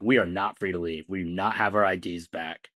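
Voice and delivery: usual speaking voice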